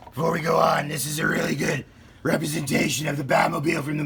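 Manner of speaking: Pete Holmes Batman. Retold or imitated. Unboxing the Batmobile for kids, as a hammed-up Batman. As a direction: play a boastful cartoon Batman. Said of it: "my best Pete Holmes Batman impersonation".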